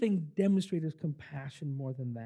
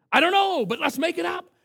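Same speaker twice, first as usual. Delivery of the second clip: put-on much higher and louder, bubbling boy; gleeful kid; 10 semitones higher, 7 dB louder than usual. Kyle telling the director to invent the dance, gleeful. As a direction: blurt it out with a kid's joy.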